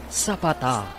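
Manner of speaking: usual speaking voice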